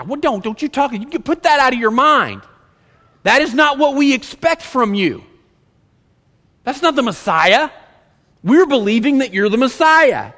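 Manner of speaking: high, loud, stumbling into protest; indignant rebuke. Acted. Acts Peter rebuking Jesus.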